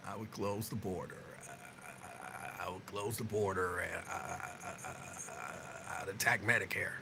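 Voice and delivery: a mock Biden losing his words; terrible Biden impression. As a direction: mumble and repeat I, I like a bad Joe Biden. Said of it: "It was a terrible Biden impersonation".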